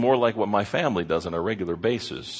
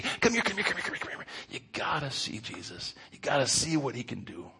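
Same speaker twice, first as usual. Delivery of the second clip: high, hushed urgent whisper; hushed excited call; 5 semitones higher, 6 dB quieter than usual. Retold or imitated. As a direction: whisper them over, thrilled.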